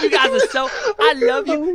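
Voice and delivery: high, loud, laughing mock affection; over-the-top loving leader parody; mock gushing Trump. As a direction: gush at the crowd, hammed-up. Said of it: "Trump was just going like".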